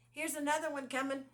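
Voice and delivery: ordinary talk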